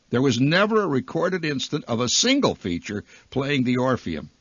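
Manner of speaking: ordinary delivery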